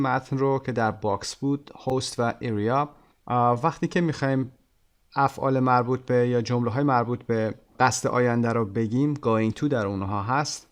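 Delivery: normal talking voice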